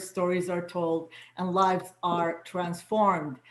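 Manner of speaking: ordinary delivery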